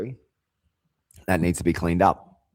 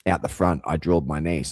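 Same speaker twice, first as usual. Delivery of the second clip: ordinary talk, nothing marked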